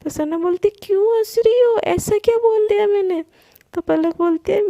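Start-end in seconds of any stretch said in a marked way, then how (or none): 0.7-3.2 higher and louder, baffled why she's laughing; lightly voiced indignant friend; puzzled protest. A narrator's reading. Sana to laughing Palak, a puzzled higher protest.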